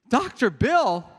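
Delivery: high, loud, drawn-out mock surprise; surprised recognition. Startled girl spots the speaker at the gym, surprised.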